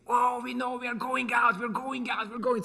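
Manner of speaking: put-on high, loud clamour of excited kids; shouting kids. Schoolkids shouting at their teacher that they're going out, high rowdy shouting. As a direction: yell over each other like a rowdy class.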